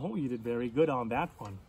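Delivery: plain reading voice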